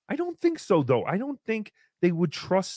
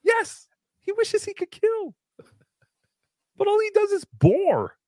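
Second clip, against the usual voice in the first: high mock voice; 14 semitones higher than usual. Mocking high voice.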